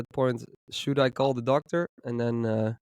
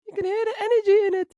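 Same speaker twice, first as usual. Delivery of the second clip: very high, fast squeaky voice; 4 dB louder, faster than usual. Shown as a demonstration. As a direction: demo a squeaky voice full of energy. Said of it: "Hi, squeaky".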